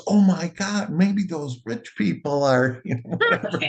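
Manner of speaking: high, excited retold gasp of wonder; mock astonishment tipping into a laugh; amazed waiter. Waiter marvels at rich people in the limo, amazed.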